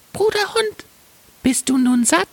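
tiny, very high-pitched bird; sparrow voice, caring. Acted. Sparrow asking its friend the dog, squeaky high.

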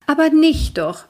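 a flattered café owner waving him off; smiling refusal. Acted. Doro refuses Rory's payment with a smile. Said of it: "wehrt Doro lächelnd ab".

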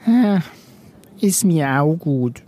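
high sigh sinking into slow agreement; sigh, then give in slowly as a little creature; heavy sigh. A narrator's reading. Chameleon Rosso reluctantly agreeing, sighing creature voice. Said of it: "Rosso seufzte schwer".

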